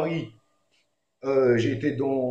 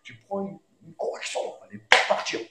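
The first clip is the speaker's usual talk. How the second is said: hushed, breathy threat of punishment; half-whispered stern parent; 10 semitones higher than usual. Retold or imitated. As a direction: warn the child under your breath. Parent's stern warning repeated.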